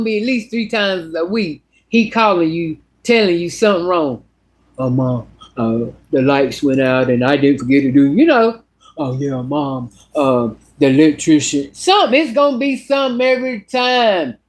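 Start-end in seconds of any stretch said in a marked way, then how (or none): 4.8-8.1 lower, put-on whiny voice of a grown son asking mom for help; mimicking her son. Voicing the needy adult son. 9.0-11.7 lower, put-on whiny voice of a grown son asking mom for help; mimicking her son. Voicing the son again.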